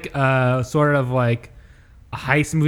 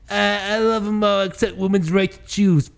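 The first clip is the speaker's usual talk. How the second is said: high-pitched put-on character voice; Turtle; 7 semitones higher than usual. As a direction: pitch it up for a mock quote. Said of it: "he makes a joke where he goes".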